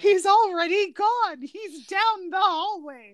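giggly disbelief; laughing. Real, not acted. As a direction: squeak it out through laughter. Laughing retelling.

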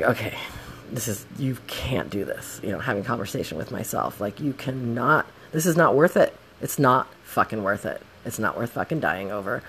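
soft, low talk to oneself; self-calming. Retold or imitated. Rider having conversation with self at home, soft self-calming. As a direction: talk yourself down quietly.

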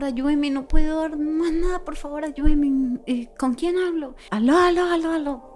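desperate plea. Retold or imitated. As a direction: plead urgently down the phone. Retells desperate phone call for help. (súplica desesperada)

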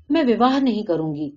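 ordinary delivery, nothing marked

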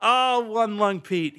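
high, slow, relishing the name; delighted drawn-out name. Real, not acted. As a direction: savor the name fondly. Savoring a backstory name.

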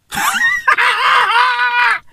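high shrieking laugh. Real, not acted. Vlogger laughing hard at the news, high shriek. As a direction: burst out in a high cackle.

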